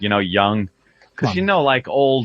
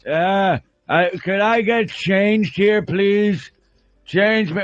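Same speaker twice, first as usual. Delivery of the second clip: put-on pleading old man; 7 semitones higher, slower than usual. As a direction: play a confused old veteran asking to be changed. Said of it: "and just like".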